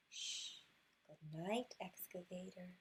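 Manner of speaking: usual speaking voice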